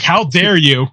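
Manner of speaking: loud, indignant mock king; mock outrage. Mocking Richard's rage in the game, loud and indignant. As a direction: bellow it like an offended royal. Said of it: "Richard's like".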